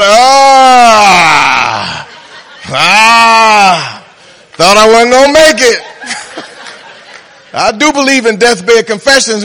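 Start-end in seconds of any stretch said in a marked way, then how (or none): 0.0-5.8 loud laughing shout of relief; relieved sinner in heaven. Pretend. Saved sinner shouting joyfully from heaven, laughing loud. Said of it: "He's up there talking about". 5.9-6.7 breathy laugh. Real, not acted. Laughing at own joke.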